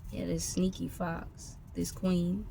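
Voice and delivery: normal talking voice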